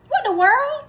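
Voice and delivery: high, loud shocked outburst; shocked disbelief. Retold or imitated. Reading a strange charge, a high shocked cry.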